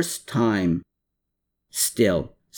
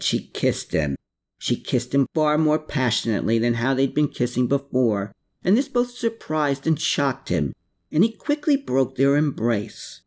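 plain reading voice

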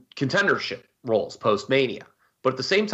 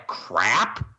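crap drawn out with emphasis; stress on crap; 2 semitones lower than usual. Real, not acted. Podcast host dismissing the Monday Night Messiah gimmick, stressing crap. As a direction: lean hard and slow on crap. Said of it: "I do stress the word crap".

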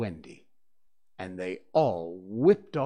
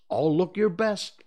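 warning; 7 semitones higher, 6 dB louder than usual. Acted. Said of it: "Peter warned them".

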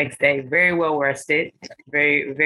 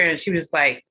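ordinary talk

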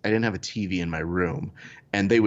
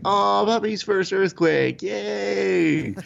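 high, slow sing-song cheer; mock cheerful; 10 semitones higher, much slower than usual. Dad cooing to puppy about first earthquake, high mock cheer. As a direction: celebrate it like a silly milestone.

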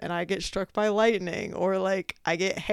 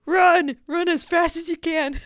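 higher, louder, half-yelled urging; urgent shout; 9 semitones higher, 7 dB louder than usual. Retold or imitated. Runner caught in hail shouting herself on, loud. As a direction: yell it like a track coach.

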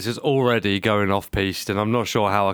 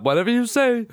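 high mock voice; 11 semitones higher than usual.

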